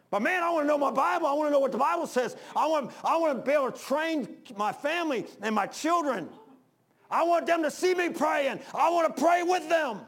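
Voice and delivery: high, loud preacher's cry; impassioned preaching. Real, not acted. Preacher longing to pray with his children, impassioned shout. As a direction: build to a full-force pulpit shout.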